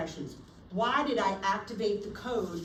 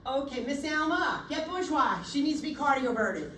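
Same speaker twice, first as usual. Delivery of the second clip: firm orders; 5 semitones higher, 5 dB louder than usual. Shown as a demonstration. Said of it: "nurse orders during V tac".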